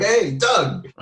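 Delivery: high, bright call of "Hey, Doug"; imitating his own cheerful hello; cheery greeting. Fan calling out to comedian Doug in the mall, high cheerful greeting.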